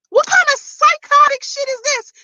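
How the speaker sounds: high incredulous exclamation; outraged. Real, not acted. Vlogger venting about Valerie's comments, high outraged disbelief. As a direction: sound stunned and disgusted.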